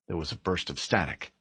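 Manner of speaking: plain reading voice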